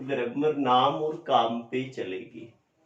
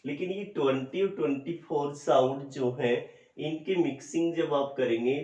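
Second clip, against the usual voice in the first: ordinary talk, nothing marked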